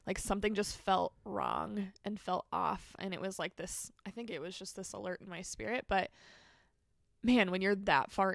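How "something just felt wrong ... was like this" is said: ordinary delivery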